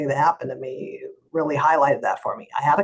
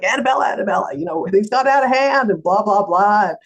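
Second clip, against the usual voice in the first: higher-pitched imitated pleading call; calling after someone; 5 semitones higher, 4 dB louder than usual. Attorney chasing departing lawyer across parking lot, imitated urgent calling. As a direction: mimic someone hurrying after her, calling her back.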